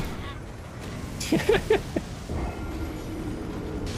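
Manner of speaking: high, airless wheezing giggle; breathless giggle. Real, not acted. Player trapped below with a monster, wheezing laugh. Cornered down in the pit.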